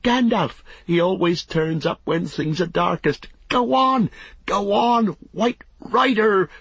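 high, exultant cheering shout; frenzied spectator's shout, pitch soaring; wild shouting. A narrator's reading. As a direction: urge on a racer with wild high yells. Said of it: "he shouted wildly".

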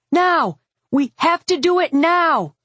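urgent high-pitched scream; high, desperate battle cry. A narrator's reading. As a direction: yell it like there is no time left. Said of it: "I screamed".

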